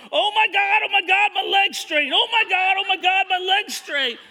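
high, loud, repeated exclaiming; hammed-up ecstatic hollering; imitated amazement. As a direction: mimic an overwhelmed man shouting in astonishment. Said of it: "he's walking around going".

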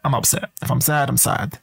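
usual speaking voice

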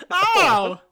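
high, loud hammed-up cry of pain; mock shot yelp. Shot archduke cries out.

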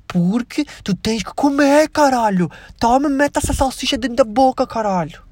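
imitating a relative barking at a kid to eat; a hint of rough insistence; forcing food. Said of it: "e dizer assim".

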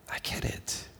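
breathy whisper of understanding; a whispered, intimate I get it; whispered God. Retold or imitated. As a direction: whisper it like God leaning close. God voiced speaking to listener.

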